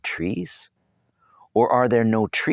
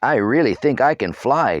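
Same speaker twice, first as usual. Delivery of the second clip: joking; 6 semitones higher, 6 dB louder than usual. A narrator's reading. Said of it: "joked Tarzan".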